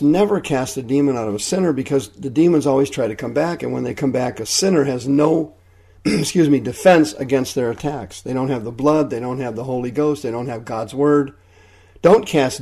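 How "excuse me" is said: usual speaking voice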